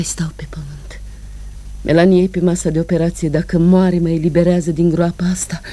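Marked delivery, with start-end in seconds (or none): 0.0-1.0 breathy despairing whisper. Acted. Woman weary of life, whispering the question. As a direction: ask it in a hushed whisper.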